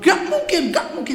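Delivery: high, loud disbelief, then settling; incredulous. Retold or imitated. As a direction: scoff at it as impossible. Father refuses to believe. (tak percaya, nada tinggi dan keras)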